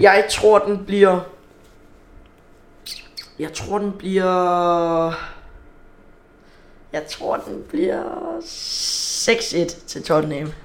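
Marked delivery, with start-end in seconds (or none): 3.4-10.5 drawn out with long pauses; slow suspense. Real, not acted. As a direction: stretch it out to build suspense. Dragging out his prediction.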